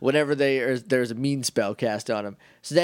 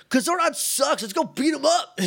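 put-on much higher, shouted teen rebellion with a laugh; mock teen shout; 12 semitones higher than usual. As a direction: yell it like a bratty teen ready to fight. Rangers turned hateful, mocking Zordon.

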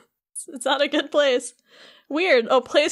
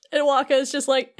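usual speaking voice

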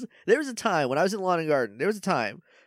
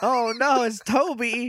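mock groan; 8 semitones higher, slower than usual. Coworkers groan at the wet boss.

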